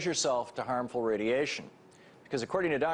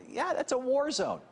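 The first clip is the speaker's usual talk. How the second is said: higher-pitched, emphatic comeback; incredulous retort; 11 semitones higher than usual. Retold or imitated. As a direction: fire back at the obvious, pitch jumping up.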